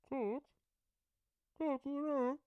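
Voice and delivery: high, distant voice from under the pillow; muffled voice. Acted. Phoebe calling from the phone under the pillow, muffled. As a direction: sound muffled, far off. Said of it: "the voice was muffled".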